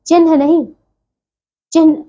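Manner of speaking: normal talking voice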